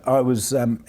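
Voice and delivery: ordinary delivery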